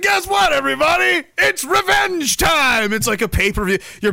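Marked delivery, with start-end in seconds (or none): 0.0-2.9 high slow showman announcement; hype announcer. Pretend. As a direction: announce it like a wrestling hype man. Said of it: "you're just like".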